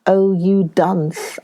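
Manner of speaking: ordinary delivery, nothing marked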